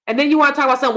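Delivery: normal talking voice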